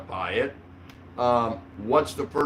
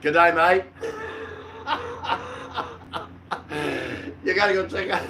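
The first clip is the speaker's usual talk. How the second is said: mock Aussie; 4 semitones higher than usual. Mock Aussie greeting on stream.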